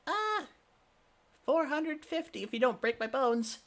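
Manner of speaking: high, nervous squeaky reply; intimidated. Acted. Shopkeeper quoting a price to a huge customer, high and nervous. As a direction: speak like you fear a beating. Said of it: "he's very intimidated".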